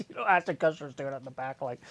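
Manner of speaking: usual speaking voice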